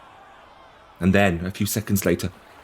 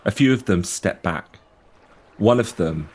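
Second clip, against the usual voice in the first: ordinary talk, nothing marked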